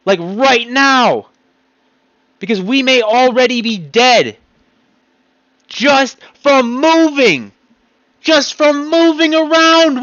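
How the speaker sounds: loud, high, slowing rant; ranting yell. Real, not acted. Ranting at the government, shouting. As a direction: yell it at the whole country. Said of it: "I'm sorry for yelling".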